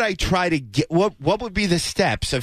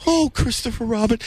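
a light hint of a cartoon bear; lightly voiced gentle bear; sweet little bear voice, only hinted; Winnie the Pooh; 6 semitones higher than usual. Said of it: "obsessed lately with Winnie the Pooh".

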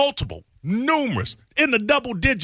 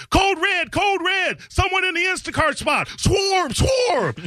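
mocking alarm; 12 semitones higher than usual. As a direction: ham up a panicked mall cop sounding the alarm. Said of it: "You laughing at it with swarm".

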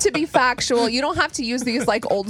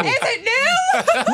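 yelling; 13 semitones higher, much slower than usual. Pretend. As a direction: bellow it playfully at a coworker. Joining the yelled compliment game.

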